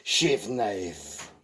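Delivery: put-on slow, playful French accent counting. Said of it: "why I did a French accent".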